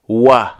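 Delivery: و said as a rounded w, lips pursed. Shown as a demonstration.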